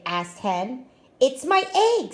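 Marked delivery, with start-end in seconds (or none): 1.2-2.1 a higher, louder, proud little boast; proudly. Acted. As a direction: puff up with pride over the egg. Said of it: "said Pig proudly".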